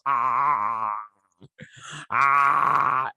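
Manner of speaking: a hammed-up wordless cry; mock yell. Crewmate yelling out his frustration, hammed-up cry. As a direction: fake a big cry of release. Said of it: "so he yells".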